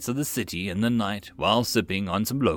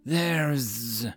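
hissing serpent; 3 semitones higher, 3 dB quieter than usual. Acted. Serpent voice continues.

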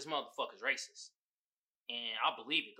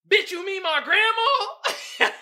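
high, slow, louder mock outrage through laughter; laughing indignation; 13 dB louder, slower than usual. Real, not acted. Answering the meme's author, high laughing mock outrage. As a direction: cackle your disbelief at the reader. Mocking an offensive meme.

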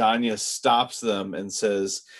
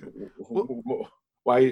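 stammered, higher-pitched disbelief, imitated; questioning stammer; 4 semitones higher, slower than usual. Podcaster acting out Kevin questioning the accent, stammering in disbelief. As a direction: mimic someone tripping over the word why. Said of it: "starts questioning the accent".

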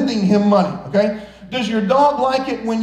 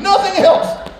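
mock boast; 10 semitones higher, 3 dB louder, slower than usual. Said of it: "I'm just kidding".

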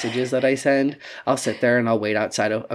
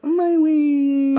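mock whine; 4 dB louder than usual.

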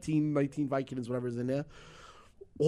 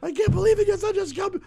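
high, loud outraged shouting; furious wife; 8 dB louder than usual. Retold or imitated. Wife finding the pills, high loud outraged shout. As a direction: blast him as a scumbag. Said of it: "she's like".

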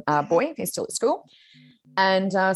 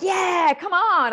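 higher cheer, a bit louder; retold excited cheer; 13 semitones higher, 3 dB louder, much slower than usual. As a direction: cheer her on like a fan. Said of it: "I was, like, really excited about it".

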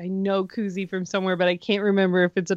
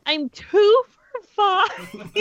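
laughing cry; 10 semitones higher, much slower than usual. Real, not acted. Laughing at her bad score.

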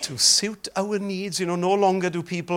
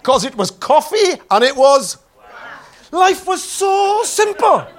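excited; 9 semitones higher, 9 dB louder than usual. Real, not acted. Lively comic sermon opening.

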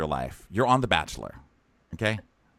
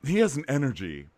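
lightly imitated smoky voice; a hint of an odd, smoky-voiced woman; smoky, weird voice; 5 semitones higher, 3 dB louder than usual. Imitating a contestant describing the bachelor, lightly smoky weird voice. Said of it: "she has a smoky, like, weird voice".

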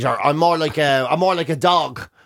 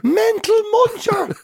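high, slow, drawn-out incredulous repeat; delighted disbelief; slower than usual. Real, not acted. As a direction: stretch it out high in gleeful disbelief. Friend repeats the nickname, delighted.

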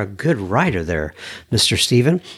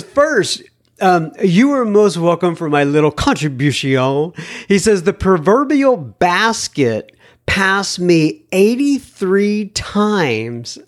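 lightly raised reading voice; email read aloud; 5 semitones higher than usual. Acted. Host reads listener email to audience, lightly raised voice. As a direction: read the letter like you're quoting him.

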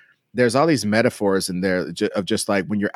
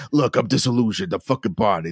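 jaded Barney. Retold or imitated.

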